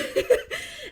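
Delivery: sudden high giggling laugh; real laugh at her own secret; high burst of laughter. Real, not acted. Laugh after the secret thought.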